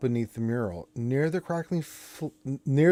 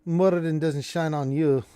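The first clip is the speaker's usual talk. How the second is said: loud, high Scottish bluster; loud Scottish dwarf; 6 semitones higher, 4 dB louder than usual. Acted. Dwarf confronts the party, loud Scottish bluster. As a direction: berate the intruders in a big Scottish voice. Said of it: "she's like".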